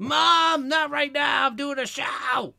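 high, loud shout at mom, laughing; mock yell at mom. Host pretends to yell at his mom during the show, high and loud. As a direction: yell up at mom to leave you alone.

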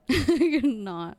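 loud, drawn-out words through laughter; helpless real laughter; laughing. Real, not acted. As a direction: crack up while saying it. Laughing while watching a clip.

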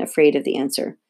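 ordinary delivery

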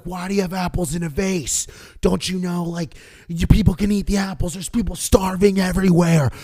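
put-on old woman ranting at the receptionist, shouting; ranting old lady. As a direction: build to a yell about the starving.

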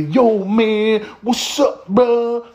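high, loud, hyped-up bro greeting; slangy street greeting. Retold or imitated. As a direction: fire off a loud, high "yo man" like a caricature.

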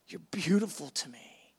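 low, soft and intimate reassurance; tender voice of God. Acted. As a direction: drop low, almost whispering your affection. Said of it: "He goes".

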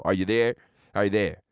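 low-pitched, measured question; low phone check. Pretend. Host on a pretend call, low and testing.

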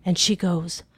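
ordinary delivery

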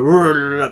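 うるるるる in a mock American R; exaggerated American R. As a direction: curl うるるるる into one long R sound. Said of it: "アメリカ人のRが嫌いなんですよ".

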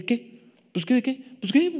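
gallito de pelea fingido, en tono agudo; imitando a peleonero. Retold or imitated. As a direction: imita un reto agudo y entrecortado de joven pleitista. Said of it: "así como gallitos de pelea". (gallito de pelea agudo, en imitación)

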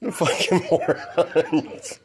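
slow, laughing contempt; scornful. Real, not acted. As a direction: sneer it out slowly.

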